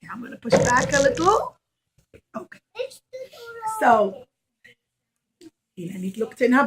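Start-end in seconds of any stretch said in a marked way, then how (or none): none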